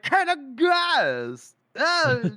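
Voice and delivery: put-on high, drawn-out drunken greeting; drunk colonel. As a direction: slur a loud, delighted hello. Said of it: "and he's, like, drunk".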